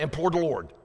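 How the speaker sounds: quick, rushed run at the phrase; fast try. Shown as a demonstration. Preacher showing the phrase said real fast, quick rush. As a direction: rattle it off fast to prove a point. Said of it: "That's hard to say real fast".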